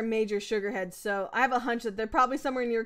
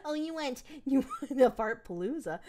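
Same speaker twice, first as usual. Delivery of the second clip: ordinary talk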